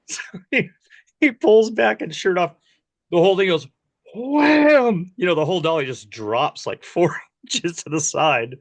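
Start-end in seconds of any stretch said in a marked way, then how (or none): 4.1-5.1 imitated crash. The dolly drops off the track.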